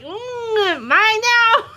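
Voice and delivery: high squeaky gloat through a laugh; smug teasing. Retold or imitated. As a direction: gloat like you just snatched her toy. Teasing friend over stolen game.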